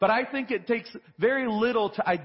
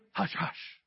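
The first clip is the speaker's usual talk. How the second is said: breathy whisper on hush-hush, much softer; 4 semitones lower, 8 dB quieter than usual. Retold or imitated. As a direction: whisper it like a secret. Keeping faith things secret.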